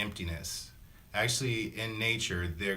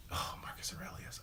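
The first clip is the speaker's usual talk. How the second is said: adoring whisper; 10 dB quieter than usual. Real, not acted. Vlogger to viewers, gushing over Marcus Aurelius in a whisper. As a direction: whisper the name, almost reverent. Fond aside about admired philosopher.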